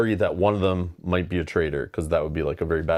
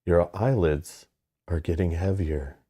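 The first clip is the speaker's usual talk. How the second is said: cult leader. Pretend. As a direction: lull them into a trance. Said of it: "You sound like a cult leader".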